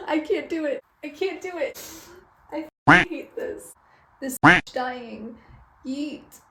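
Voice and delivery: hammed-up, high-pitched distress, breaking off into a whisper; stop-start overwrought despair; mock anguish. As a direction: wail that you can't go on, overdramatically. Acts out agony at the twist.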